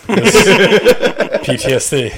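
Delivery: slow, high laughing words. Real, not acted. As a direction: laugh it out, drawn out. Laughing about the memory.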